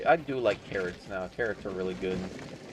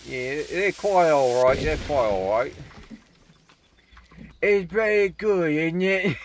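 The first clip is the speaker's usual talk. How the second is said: hammed-up posh British accent, higher and louder; mock British accent; 7 semitones higher, 6 dB louder than usual. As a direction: play a silly Brit, pitch up on "It's very good". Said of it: "I know that's not how British people sound".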